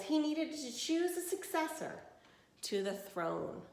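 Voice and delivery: clear, even storybook reading; reading to children. Acted. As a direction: read it to a class of kids. Reads picture book to class.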